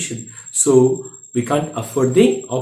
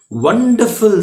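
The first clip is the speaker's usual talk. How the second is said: bright, delighted exclamation; light up with delight on the word; smiling; 7 semitones higher, 4 dB louder, slower than usual. Acted. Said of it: "smiled the man".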